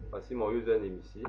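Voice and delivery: ordinary talk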